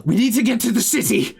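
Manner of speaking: high-pitched urgent shout; panicked yell. Acted. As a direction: yell it out as you run past. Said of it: "they're yelling at you".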